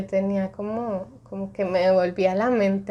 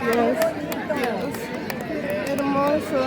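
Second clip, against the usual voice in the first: moved to tears; 5 semitones higher, much slower than usual. Real, not acted. Watching the eclipse end, moved to tears, slow awed voice. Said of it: "con la lágrima".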